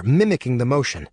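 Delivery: ordinary delivery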